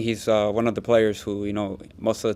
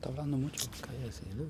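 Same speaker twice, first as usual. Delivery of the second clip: quiet aside; 4 semitones higher, 13 dB quieter, much faster than usual. Real, not acted. Muttered aside during press conference.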